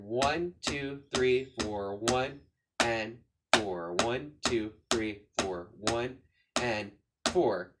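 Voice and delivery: measured beat-by-beat count with gaps; rhythmic counting. Shown as a demonstration. Drum teacher explaining the accent pattern, rhythmic counting.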